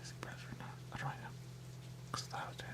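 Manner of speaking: very soft unvoiced whisper. Real, not acted. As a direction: barely breathe the words. Whispered musing, much quieter.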